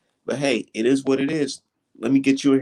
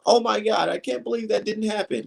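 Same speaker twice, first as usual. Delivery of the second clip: raised-pitch mock disbelief; mock outrage; 7 semitones higher than usual. Host mimicking viewers upset over the Rigo fight, mock outraged. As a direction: imitate upset fans who can't believe it. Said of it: "everybody feeling a certain type of way".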